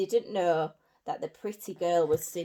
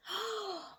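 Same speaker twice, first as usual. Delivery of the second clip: high gasp of delight; 12 semitones higher, 6 dB quieter than usual. Acted. Shoe fits Cinderella.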